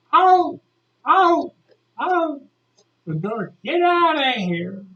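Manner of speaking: high, furious mock shouts; teacher yelling. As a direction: order a pupil out in bursts. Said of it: "She's yelling and yelling and yelling".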